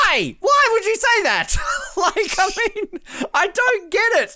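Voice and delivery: high, laughing disbelief; can't believe what she just said; real exasperated laugh at a TV moment; incredulous. Real, not acted. Disbelief at Imogen's blunder.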